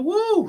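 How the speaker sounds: very high-pitched "woo"; exhausted whoop. Real, not acted. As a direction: let out a high whoop of relief. Exclaims at how hard talking is.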